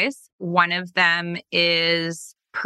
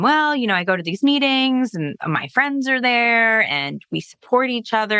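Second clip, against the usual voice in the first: put-on gentle explaining to child; 6 semitones higher than usual. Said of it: "I explained to him".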